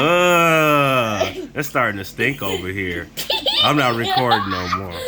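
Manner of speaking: laughing, groaning at a smell; laughing disgust. Real, not acted. Dad reacts to burp stink.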